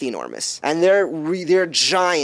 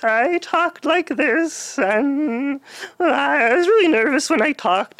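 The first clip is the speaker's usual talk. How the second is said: high, slow, nervous nerd voice; squeaky nervous nerd talking; nerdy voice; 10 semitones higher, slower than usual. Shown as a demonstration. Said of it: "I had this really nerdy voice".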